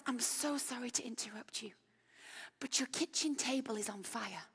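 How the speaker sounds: a calm, hushed aside about a fire; polite whisper. Retold or imitated. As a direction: whisper urgent news as politely as possible. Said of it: "beckons me quietly 'cause he's so polite".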